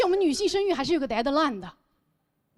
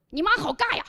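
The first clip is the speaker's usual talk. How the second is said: higher-pitched mock schoolkid jeer; taunting kid; 7 semitones higher than usual. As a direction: tease like another child on the playground. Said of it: "别的小朋友只需要一句话就能击碎他". (模仿小朋友高声嘲笑)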